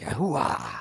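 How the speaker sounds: old Pacino catchphrase impression. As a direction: do an older actor's drawn-out catchphrase. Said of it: "old Pacino where he's like".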